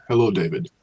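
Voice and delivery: normal talking voice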